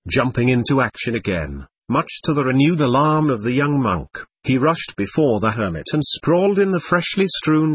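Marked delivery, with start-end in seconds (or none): none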